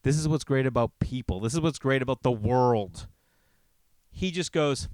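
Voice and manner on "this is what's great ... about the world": ordinary talk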